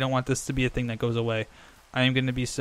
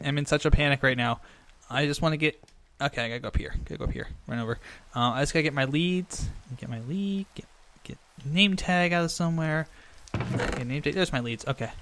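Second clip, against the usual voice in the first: normal talking voice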